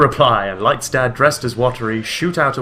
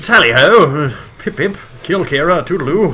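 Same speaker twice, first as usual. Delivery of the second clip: higher, louder hammed-up English toffs; fake English accent; 5 semitones higher, 7 dB louder than usual. Joking with co-host, over-the-top English accent. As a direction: burst out with mock British catchphrases. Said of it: "doing a fake English accent".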